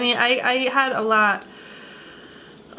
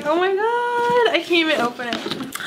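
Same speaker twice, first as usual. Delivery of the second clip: ordinary talk, nothing marked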